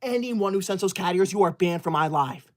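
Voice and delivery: normal talking voice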